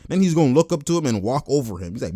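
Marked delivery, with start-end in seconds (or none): none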